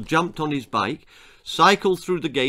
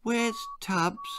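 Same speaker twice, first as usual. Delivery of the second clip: put-on high, soft little boy's voice; a small boy anxious about his rabbit; boy asks the doctor for his rabbit, softly and high=96; worried boy; 10 semitones higher, 6 dB quieter than usual.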